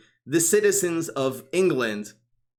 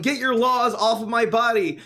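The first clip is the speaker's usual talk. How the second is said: raised, high-pitched imitation of protesters; mock yelling; 8 semitones higher, 4 dB louder than usual. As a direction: mimic a protest slogan being yelled, not at full volume. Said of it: "the pro-abortion people are yelling".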